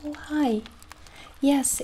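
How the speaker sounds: normal talking voice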